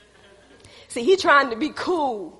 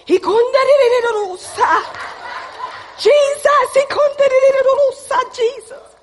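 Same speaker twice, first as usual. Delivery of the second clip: high, loud mock-frantic tongues prayer; hammed-up, high-pitched cry to Jesus; praying in tongues; 11 semitones higher, 9 dB louder than usual. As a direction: wail out rapid syllables, imitating fervent prayer. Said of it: "I'm praying in tongues".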